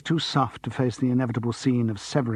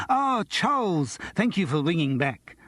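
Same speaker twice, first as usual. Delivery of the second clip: much higher, slow grand greeting; grand agent; 6 semitones higher than usual. Acted. Agent on the payphone to Charles, grandly. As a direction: greet him grandly, drawing it out. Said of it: "said Morris grandly".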